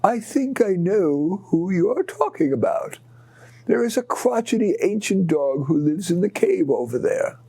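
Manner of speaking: high-pitched owl giving directions. Acted. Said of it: "the owl said".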